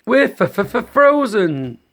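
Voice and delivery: teeth chattering, stammer each word; shivering rabbit. A narrator's reading. Parent reads the frozen rabbit, stuttering and shivering. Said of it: "said one".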